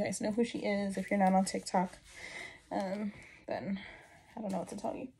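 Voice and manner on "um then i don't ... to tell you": usual speaking voice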